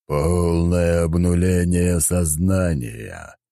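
slow, rasping and commanding; a hoarse voice booming from the dark; raspy powerful voice. Acted. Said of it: "прозвучал хриплый, но мощный голос".

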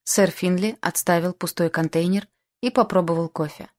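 ordinary delivery

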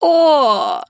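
loud, high howl; howling gargoyle. Acted. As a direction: howl it like a stone beast. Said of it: "провыла голова статуи".

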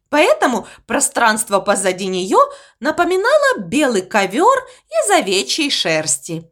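ordinary delivery

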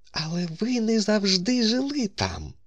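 high, sustained feminine character voice; actress's objection. A narrator's reading. Actress turning back to the doctor, high-voiced objection.

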